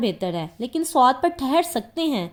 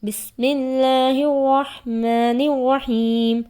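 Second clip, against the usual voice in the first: Quranic recitation; much slower than usual. Real, not acted. Opening Quran recitation before lesson.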